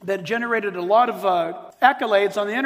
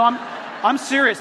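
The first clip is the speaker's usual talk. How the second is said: normal talking voice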